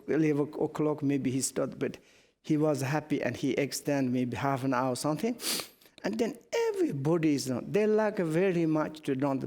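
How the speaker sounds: ordinary talk, nothing marked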